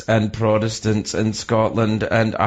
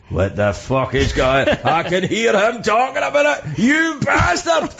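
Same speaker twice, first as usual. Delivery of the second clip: mock fury building to a high shout; outraged Scottish listener; 10 semitones higher than usual. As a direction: start slow, then erupt into a high, drawn-out yell. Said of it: "someone just went".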